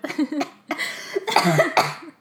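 helpless fit of giggles; real laughter. Real, not acted. Daughter amused by her dad's first words, laughing hard.

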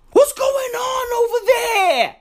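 high, shouted disbelief; exasperated shriek. Real, not acted. Ranting about the WHO to viewers, a high shout. Shrieked outburst, then repeated plainer.

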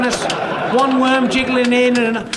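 ordinary delivery

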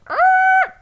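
very high, loud screech noise; shrill mock brake squeal; imitated screeching halt.

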